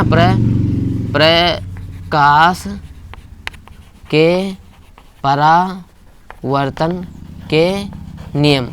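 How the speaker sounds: प्रकाश के परावर्तन के नियम spoken word by word with long gaps; teacher dictating a heading very slowly, softly; slow dictation. Shown as a demonstration. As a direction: dictate each word slowly so students can write. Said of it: "dictating the rule for notes".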